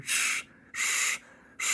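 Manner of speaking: soft unvoiced steam hiss. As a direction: hiss like a steam engine letting off steam. Steam sounds.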